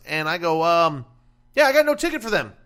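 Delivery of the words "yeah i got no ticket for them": exasperated. Retold or imitated. Retelling a kitchen mix-up.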